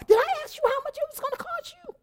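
high, fast mock scolding of the husband; bossy lady. Motel lady scolding Lewis in a high, fast mock voice.

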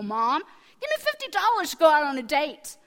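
loud mock teen demand; demanding teenager. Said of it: "When an eighteen-year-old comes in and says".

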